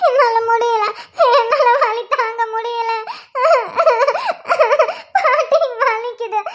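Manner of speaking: slow, drawn-out wailing words; sobbing in pain. Acted. Woman in labour tells grandmother she can't bear it, wailing slowly. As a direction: drag the words out through sobs. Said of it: "அவ கத்துறா".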